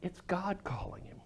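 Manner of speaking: hushed whisper of realization; awed whispered reveal. Acted. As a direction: breathe it like a secret. Dramatic realization in sermon.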